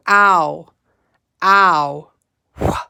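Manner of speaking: loud "Ow, ow, wha" as bare sounds; chunk sounds. Shown as a demonstration. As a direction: call out each chunk sound loudly. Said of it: "say the sound of the chunks".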